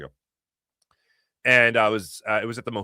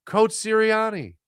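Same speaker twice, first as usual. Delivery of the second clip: calling out; 11 semitones higher, 4 dB louder, slower than usual. Retold or imitated. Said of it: "she goes".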